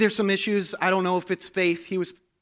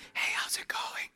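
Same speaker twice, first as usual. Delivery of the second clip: breathy near-silent whisper of a greeting; failed whisper; 10 dB quieter than usual. Retold or imitated. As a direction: whisper it under your breath. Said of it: "They can't go".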